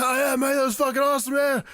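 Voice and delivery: high, loud mock-drunk cheer; drunk enthusiast. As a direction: imitate a drunk guy yelling his approval. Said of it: "guarantee Grado was probably drunk".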